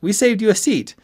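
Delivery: high, loud character voice; WE said high and eager, no correction; eager friend. A narrator's reading. Friend telling him about the saved seat, high and loud. Ben's line, then corrected by Ski.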